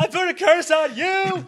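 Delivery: high, slow, laughing curse; hammed-up laughing curse voice; laying a curse. Quoting Mushu curse line.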